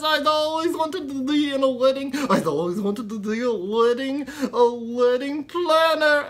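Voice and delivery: very high, loud, excited puppet pig; squealing puppet, thrilled then heartbroken; squeaky ventriloquist dummy. Acted. Pig puppet overjoyed then dismayed.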